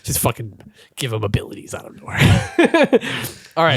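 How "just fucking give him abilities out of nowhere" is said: hushed muttered complaint; whisper. Real, not acted. Player whispering a gripe at the table. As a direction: whisper it bitterly under breath.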